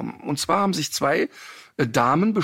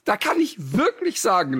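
ordinary talk, nothing marked

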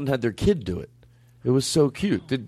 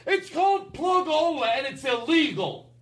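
mock outrage; 12 semitones higher than usual. Host denying he takes plugs, mock outraged. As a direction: protest it way up high. Indignant denial of doing a plug.

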